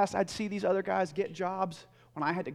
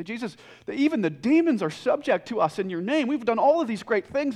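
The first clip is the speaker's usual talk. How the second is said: re-enacted excitement, higher and louder; excited report; 5 semitones higher, 9 dB louder than usual. Disciples report back to Jesus after being sent out, excited. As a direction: burst into it like thrilled followers back from a mission. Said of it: "they're so excited".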